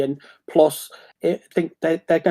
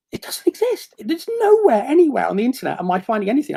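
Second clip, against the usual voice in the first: much higher, amazed voice; incredulous excitement; 6 semitones higher than usual. Retold or imitated. Searching the internet for a unique style, high amazed disbelief. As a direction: sound thrilled and disbelieving. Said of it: "I'll look and go".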